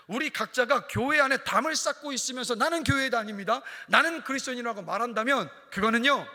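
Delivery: impassioned sermon voice; forceful preaching. Real, not acted. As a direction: drive it home to the congregation.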